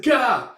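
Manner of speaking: a high, loud cry of pain; pained gah. Retold or imitated. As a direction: cry out as if hit. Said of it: "He's like".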